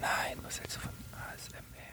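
hushed ASMR-style whisper. Pretend. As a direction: breathe the question like an ASMR video. Teasing ASMR whisper question.

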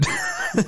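short, very high, breathless laugh; a voiceless squeal of laughter; high squeaky laugh. Real, not acted. Still laughing with his cohost about the attack, squeaky laugh.

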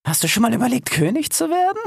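high, insinuating hyena voice. A narrator's reading. Hyena tempts the fox with kingship, high sly voice. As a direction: lift it way up, rising into the question.

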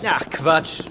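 ordinary delivery

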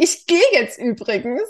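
theatrical exit. Retold or imitated. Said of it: "so einen theatralischen Abgang".

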